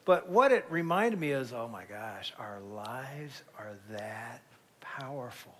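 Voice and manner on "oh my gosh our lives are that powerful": hushed awe. Real, not acted. Emphatic awe at the lesson.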